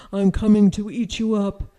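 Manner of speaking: loud, quick troll threat; storybook troll shouting, hurried and loud. A narrator's reading. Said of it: "shouted the troll".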